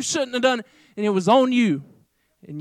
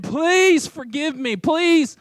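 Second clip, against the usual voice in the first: slow, higher-pitched acted plea; mock begging; 5 semitones higher, much slower than usual. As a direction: beg for mercy, dragging out each please. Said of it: "this guy's begging him".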